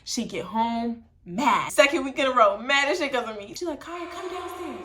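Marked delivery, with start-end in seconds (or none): 3.8-4.8 mock whisper. Mom calls daughter down.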